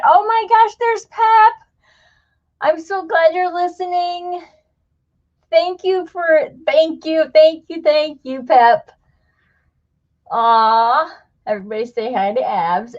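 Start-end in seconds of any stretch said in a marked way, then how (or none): 0.1-8.9 high, bright burst of delight at a friend; thrilled hello, much higher; excited greeting. Real, not acted. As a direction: squeal hello to a friend who showed up. Spotting a friend in chat.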